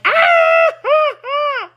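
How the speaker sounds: shrill high fake laugh, forced; a forced, squealing fake laugh. Annoyed at being called Jill, forced high laugh. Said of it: "And I'm like".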